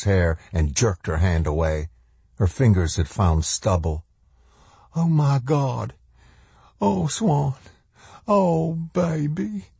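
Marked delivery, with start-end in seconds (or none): 5.0-9.7 high, slowing cry of heartbreak; dismayed mother. A narrator's reading. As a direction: lament over a hurt child in a high voice. Mother discovers daughter's hair is gone.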